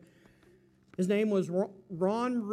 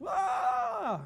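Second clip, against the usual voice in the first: crowd whoa; 10 semitones higher than usual. Retold or imitated. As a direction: cheer it like the whole room is amazed. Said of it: "everybody just".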